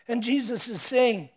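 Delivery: ordinary talk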